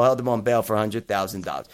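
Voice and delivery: ordinary delivery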